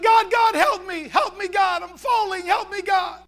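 high, loud panicked plea; acted desperate shouts to God; crying out. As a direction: cry out for help as if falling. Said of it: "he began to cry out to God".

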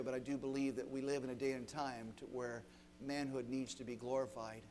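soft, low prayer voice; preacher praying over the school, low and hushed; closing prayer. Acted. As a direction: pray quietly over the students.

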